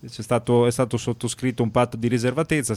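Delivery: usual speaking voice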